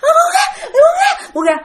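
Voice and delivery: shrieking, very high wail; mock tormented crying plea; imitated agonized plea. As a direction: act out frantic howls to be let go. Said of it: "ổng cứ làm thấy ghê vậy đó".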